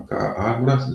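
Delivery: usual speaking voice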